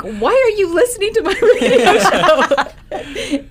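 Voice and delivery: high, loud mock disbelief breaking into laughter; acted-out bewilderment through laughter; incredulous, laughing. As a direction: blurt the question, cracking up before the end. Retelling her amazed question, laughing.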